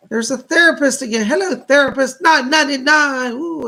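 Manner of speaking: high, loud, excited shout-out; thrilled greeting. Real, not acted. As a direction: call out the donor and gift with real delight. Thrilled host greets a returning donor.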